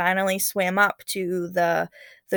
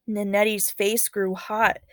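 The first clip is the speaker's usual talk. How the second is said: plain reading voice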